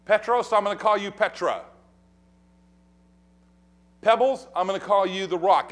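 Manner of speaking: preacher voicing Jesus in full; preaching build. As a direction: build it up with force. Preacher voicing Jesus naming Peter.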